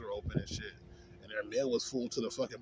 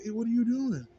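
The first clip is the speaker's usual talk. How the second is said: higher mock call of a security guard; imitating the guard, pitched up; 9 semitones higher, 3 dB louder than usual. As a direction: throw it out like a nosy guard at the glass. Security guard knocking on window.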